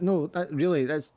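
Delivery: high, hesitant protest; flustered polite refusal. Acted. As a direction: politely wave away an offer of help. Flustered woman politely refuses help.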